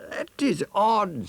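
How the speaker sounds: put-on very high, slow, wondering child voice; puzzled elephant's child. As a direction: muse it like a puzzled little one. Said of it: "said the elephant's child".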